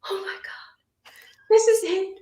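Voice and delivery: high, building to a loud joyful cry; overwhelmed with joy at a live show; retold rapture. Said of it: "I was like".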